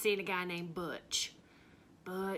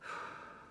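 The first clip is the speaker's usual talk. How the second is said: heavy sigh; 10 dB quieter than usual. Acted. After the confession.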